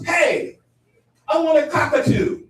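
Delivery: high, loud mock-eager voice; eager friend. Friend announcing wish for a cockatoo, mock-eager. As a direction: announce it with hammed-up enthusiasm.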